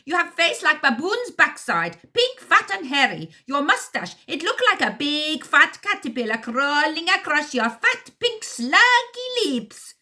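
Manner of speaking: put-on high loud ranting insults, heavy accent, slowing at the end; first henchman ranting at partner, heavy accent; angry accented tirade. A narrator's reading. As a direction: rant the insults, thick accent.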